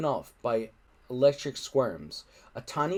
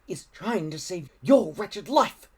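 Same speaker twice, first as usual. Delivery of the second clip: scared but brave; 6 semitones higher, 4 dB louder than usual. Acted. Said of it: "as terrified as Alvin, but bravely".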